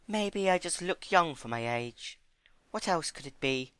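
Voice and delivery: high, gentle pony voice; high timid pony. Acted. Fluttershy voiced.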